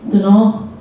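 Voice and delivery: "Dunno" in a northern English accent; northern English "dunno", run into one word. Shown as a demonstration. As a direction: say "Dunno" as a Durham lad would. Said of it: "how does he say it?".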